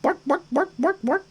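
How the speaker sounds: high-pitched, quick Muppet-chef "bork bork"; Swedish Chef. Retold or imitated. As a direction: chirp it like a cartoon Swedish cook, light and high. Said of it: "I sound like the Swedish chef".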